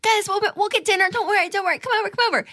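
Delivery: high, giddy invitation; over-the-top joyful excitement; excited, joyful. Acted. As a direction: gush an invite to everyone, way up high. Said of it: "I get so excited, so joyful".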